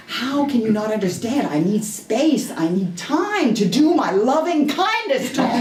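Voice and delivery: high, loud exasperated blow-up; retold angry outburst. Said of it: "And I got angry".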